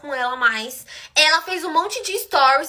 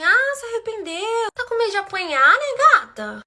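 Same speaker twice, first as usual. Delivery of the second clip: mocking taunt; 7 semitones higher than usual. Storyteller to the crying girl, mocking taunt. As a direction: tease her in a sing-song high voice.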